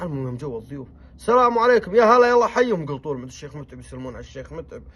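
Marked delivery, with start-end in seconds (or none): 1.2-2.9 high, loud welcome call; loud greeting. Retold or imitated. Guests arriving and the host calling a welcome, loud. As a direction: call out the greeting across the camp.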